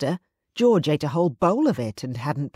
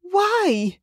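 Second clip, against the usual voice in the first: much higher, louder anguished cry; anguished gasp; 4 dB louder than usual. A narrator's reading. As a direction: break out the question in pain. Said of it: "she gasped, anguished".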